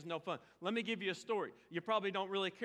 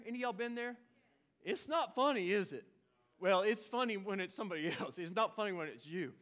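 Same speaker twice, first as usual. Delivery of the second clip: usual speaking voice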